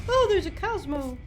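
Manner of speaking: high, loud "oh, there's a Cosmo"; excited shout. Real, not acted. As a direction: call out in delight.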